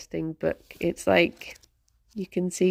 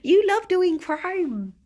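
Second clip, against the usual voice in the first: pet talk; 11 semitones higher, 4 dB louder, slower than usual. Real, not acted. Streamer to her crime-loving pet, high teasing coo.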